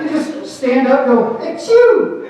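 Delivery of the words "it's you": high, loud delighted squeal; delighted it's you. Pretend. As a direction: squeal it like a happy surprise. Acted morning-after line.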